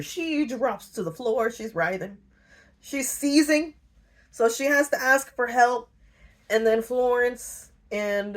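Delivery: ordinary delivery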